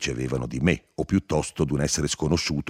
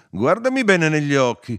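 loud, high, commanding cry; shouting; 9 dB louder than usual. A narrator's reading. Narrator makes Firmo face him, shouting. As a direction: shout it as an order. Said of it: "gli gridai".

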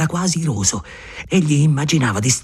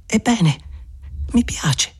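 shy Maria; 8 semitones higher, 3 dB quieter, slower than usual. Acted. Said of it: "quasi timida".

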